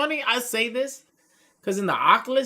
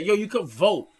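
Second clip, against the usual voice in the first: ordinary delivery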